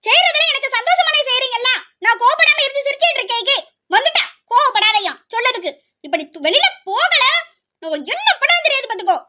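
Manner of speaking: high, bitter laugh turning into a threat; mock her, then threaten her; furious sarcasm. Elder sister sneers and threatens the younger sister, furious.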